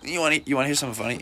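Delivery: very fast, rushed question; fast teasing setup. Retold or imitated. Teasing Brent before the punchline, rushed. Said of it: "I'm just like".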